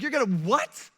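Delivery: high, incredulous question; acted astonishment, pitch jumping; shocked disbelief. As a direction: blurt it out, stunned. Acted angels shocked by God's answer.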